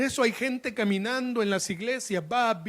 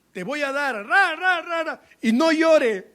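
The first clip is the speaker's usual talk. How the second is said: high, loud hammed-up scolding; mock parental threat; 8 semitones higher, 7 dB louder than usual. Preacher mimicking an adult spanking a child, loud mock scolding. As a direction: imitate a parent thrashing out each threat. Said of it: "¿cómo le decís a un niño".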